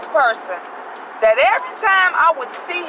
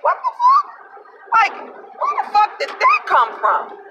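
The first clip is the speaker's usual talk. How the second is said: much higher, slow, stunned outburst; shocked disbelief; 13 semitones higher than usual. Retold or imitated. Driver stunned when toll man finally thanks her, high squeal. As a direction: caught completely off guard, squeal it out. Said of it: "I was like".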